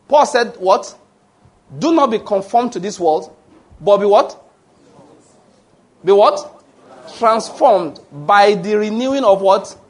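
fervent sermon voice, high and slow; preaching at full force. Acted. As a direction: preach it out, drawing each phrase long. Call and response sermon.

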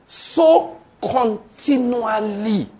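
preacher drawing out the answer; slow drawn-out answer. Shown as a demonstration. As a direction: stretch every word out slowly.

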